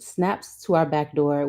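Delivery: ordinary delivery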